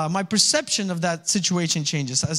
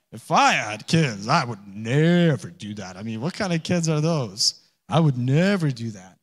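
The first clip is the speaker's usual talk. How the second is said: mock self-righteous voice, lower pitch; smug non-parent; 6 semitones lower than usual. Mocking know-it-all non-parents.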